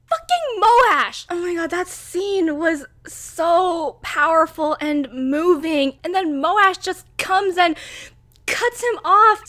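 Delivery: high, loud, slow outrage; worked up. Real, not acted. Fan cursing the killer of the king, loud and slow. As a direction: spit the name out in fury. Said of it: "I'm getting way too worked up".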